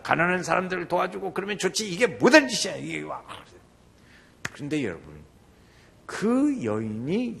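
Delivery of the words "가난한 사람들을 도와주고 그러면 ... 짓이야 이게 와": loud protest, easing at the end; acted indignant disciples. Disciples objecting to the costly perfume, acted indignation. 제자들이 옆에 있다가.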